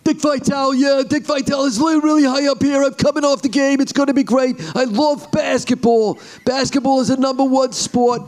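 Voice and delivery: very high, excited sportscaster; Dick Vitale. Shown as a demonstration. Commentator calling a three-point basket, high-pitched excitement. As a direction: impersonate a frenzied basketball commentator. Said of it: "Can you do any commentators like Dick Vitale?".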